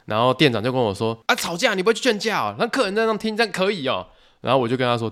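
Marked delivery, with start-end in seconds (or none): 1.3-4.1 higher-pitched mock scolding; a hint of a boss chewing you out; imitating a scolding boss. Store manager scolding the worker for not stopping the fight, mock scolding.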